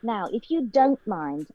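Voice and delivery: Emma Watson's Harry Potter line in British English; measured, huffy British delivery, slowing onto the last word; British accent. Shown as a demonstration. Guest performs a Harry Potter line on request, British accent. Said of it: "say that one line from Harry Potter".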